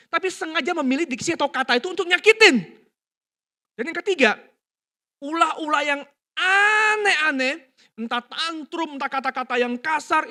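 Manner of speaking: loud, high, driving sermon delivery; preaching at full force. Acted. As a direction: preach it hard to the congregation.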